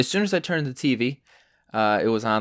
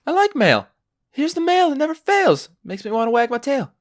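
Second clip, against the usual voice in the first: gleeful; 12 semitones higher, 4 dB louder than usual. Real, not acted. Gleeful mail ditty.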